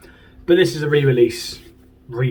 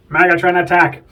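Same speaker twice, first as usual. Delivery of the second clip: loud, higher-pitched talking-toy battle cry; Megatron toy; 5 semitones higher, 6 dB louder than usual. Retold or imitated. Collector imitates his brother's talking figure, loud toy voice. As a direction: imitate a toy's shouted catchphrase. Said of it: "Sounds nothing like Megatron".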